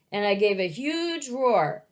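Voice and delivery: plain reading voice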